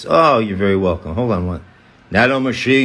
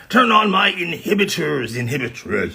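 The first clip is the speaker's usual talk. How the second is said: character voice; 5 semitones higher than usual. Pretend.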